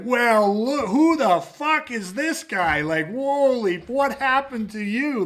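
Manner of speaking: loud, high, drawn-out astonishment; imitating amazed instructors, stretched and high; mock amazement. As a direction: hoot it like instructors teasing a student.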